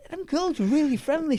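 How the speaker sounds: high, loud mock innocence; naive remark. Retells own naive remark to companion.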